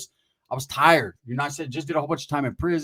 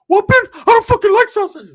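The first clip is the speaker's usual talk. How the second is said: very high, loud cartoon squeak; 13 dB louder than usual. [name] squealing at his cousin in a Mickey Mouse voice. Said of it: "from Mickey Mouse to [name]".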